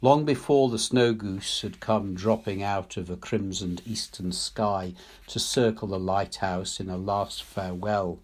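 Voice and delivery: ordinary delivery, nothing marked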